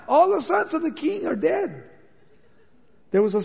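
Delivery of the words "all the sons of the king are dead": high, stunned cry of disbelief; shocked disbelief. Acted. David hearing his sons are dead, high stunned disbelief. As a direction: react as if struck by the news.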